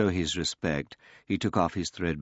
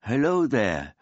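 put-on high, friendly call of an old man; 8 semitones higher, 6 dB louder than usual. A narrator's reading. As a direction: call out a cheerful greeting. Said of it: "the old man called out".

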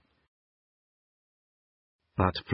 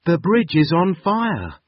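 alarmed; 8 semitones higher, 4 dB louder than usual. Acted. Floppy trapped on burning bridge.

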